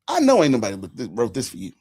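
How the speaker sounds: ordinary talk, nothing marked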